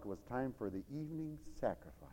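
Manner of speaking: normal talking voice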